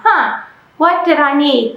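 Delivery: ordinary delivery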